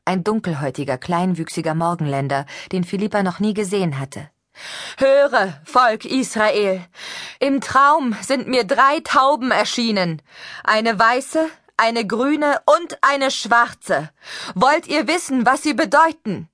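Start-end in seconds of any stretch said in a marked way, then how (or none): 5.0-16.5 high, loud, slow prophetic call; a stranger prophesying loud and high to the crowd; high preaching voice. Acted. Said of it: "eine hohe Stimme".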